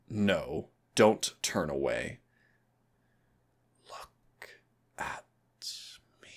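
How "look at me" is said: breathy whisper, far softer. Acted. Demon coaxing the turned-away listener, soft whisper. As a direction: hush it, slow and close.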